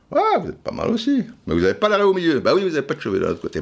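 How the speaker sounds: mock female admirer.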